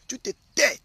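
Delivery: loud, high acted command; silencing wife. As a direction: play a wife ordering her husband to shut up. Acts wife silencing her husband.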